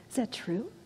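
soft, hurt question; God softly asking Eve, heartbroken. Retold or imitated. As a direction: ask it quietly, heart breaking.

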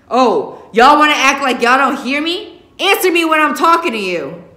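loud, high furious father shouting; angry shout. Acted. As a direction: demand an answer at full volume. Father yelling at kids publicly.

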